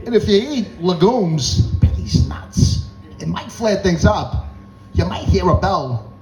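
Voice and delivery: slow, high, halting cadence with odd pauses; Christopher Walken. Acted. Doctor explaining the condition, as Christopher Walken. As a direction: stretch key words and lurch between phrases. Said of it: "he sounds like Christopher Walken".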